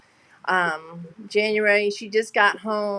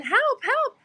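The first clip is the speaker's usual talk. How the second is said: much higher mock cries for help; imitating mom's scream. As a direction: echo her panicked yell, pitched way up. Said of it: "And she starts screaming".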